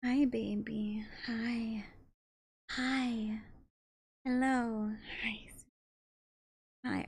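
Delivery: slow hushed hellos to a pet; softly greeting the cat in her arms; soft cooing. Real, not acted.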